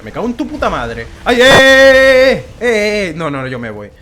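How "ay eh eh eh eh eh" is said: loud, high, drawn-out panicked yells; startled scream at the dragon; panicked yelling. Real, not acted. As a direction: cry out as the fire comes at you. Dragon fire hits near him.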